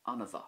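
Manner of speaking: Anna bha with mh as a V, anna va. Shown as a demonstration. Teacher showing learners bha with a V sound. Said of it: "the M H there making a V sound".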